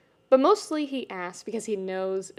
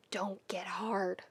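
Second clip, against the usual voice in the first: slow unvoiced whisper; 10 dB quieter, slower than usual. A narrator's reading. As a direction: mutter the mantra under breath. Inner mantra whispered.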